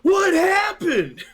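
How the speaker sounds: high, slow, shouted What happened; hammed-up police outcry; mock cop shout. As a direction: bellow it like a shocked cop. Acting the cop skit line.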